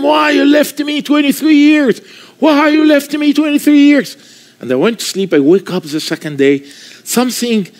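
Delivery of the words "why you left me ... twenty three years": crying plea. Retold or imitated. Convert crying out to God alone in his room, mild crying plea. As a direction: cry out the question in hurt. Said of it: "I start crying and I told them".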